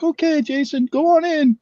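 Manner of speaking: put-on high, breezy girl's voice; mimicking the door girl. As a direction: chirp it like a bored door clerk. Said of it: "the girl just like".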